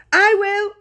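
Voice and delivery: put-on high, loud old man volunteering; eager shout. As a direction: shout it like a thrilled grandfather. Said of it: "shouted Grandpa Joe".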